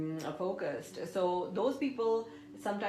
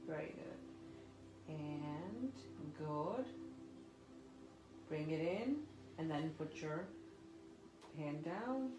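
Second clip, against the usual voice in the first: soft spa whisper; 4 semitones lower, 12 dB quieter than usual. Acted. Guides breathing exercise softly.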